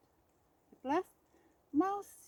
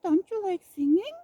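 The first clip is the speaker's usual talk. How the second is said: surprised mouse; 7 semitones higher, 8 dB louder than usual. A narrator's reading. Mouse asks brightly.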